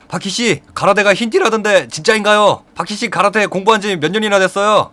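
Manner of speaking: high-pitched, excited press questions; eager reporters. Acted. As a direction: pester him like a pack of reporters. Crowd of reporters firing questions.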